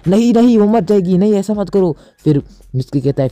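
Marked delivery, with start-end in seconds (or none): 0.1-2.0 high, loud panicked no no; alarmed protest. Acted. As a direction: stop him in a sudden high panic. Boruto stops Mitsuki breaking arm. (ऊँची, ज़ोरदार घबराई हुई आवाज़ में रोकना)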